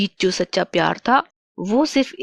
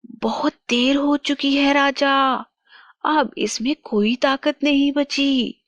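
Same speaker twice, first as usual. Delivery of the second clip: gloating witch; 6 semitones higher than usual. Acted. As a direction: gloat that the rescuer is too late. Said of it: "चुड़ैल बोली". (इतराती चुड़ैल, ऊँची आवाज़)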